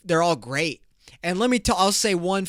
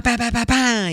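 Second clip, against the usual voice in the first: mock gunfire; 8 semitones higher, 7 dB louder than usual. Mimics shooting a gun.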